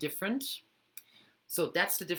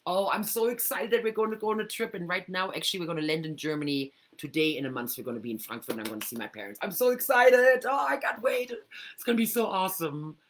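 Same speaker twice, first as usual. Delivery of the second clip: higher, louder, gushing with excitement; bubbling excitement; 4 semitones higher, 6 dB louder than usual. Shown as a demonstration. Thrilled about seeing her parents, gushing excitedly. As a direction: squeal over the trip like you can't wait. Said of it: "That excitement that you're feeling".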